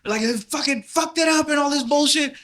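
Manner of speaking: high, loud drill sergeant rant; a hint of a yelling drill sergeant; mock drill sergeant. Drill sergeants in basic training yell at [name], lightly done. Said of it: "drill sergeants were yelling at me".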